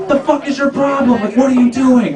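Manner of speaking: high-pitched indignant confrontation, acted out; mock outraged girlfriend. Girlfriend confronts him over film.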